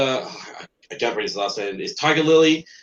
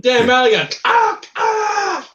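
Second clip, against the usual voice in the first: mock yell; 4 dB louder than usual. Quoting caravan beating alligator.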